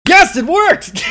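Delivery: high, loud triumphant shout; excited cheer. Real, not acted. Streamer cheers as the donation voice works, triumphant shout. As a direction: burst out celebrating, pitch way up. Celebrates working text-to-speech donation.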